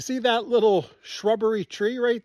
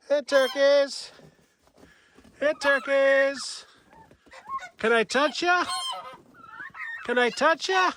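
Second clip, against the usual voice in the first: coaxing the turkeys; 8 semitones higher than usual. Real, not acted.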